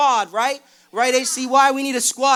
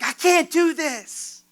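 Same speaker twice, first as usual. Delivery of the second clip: despairing plea; 9 semitones higher, much slower than usual. Retold or imitated. Despair on the phone.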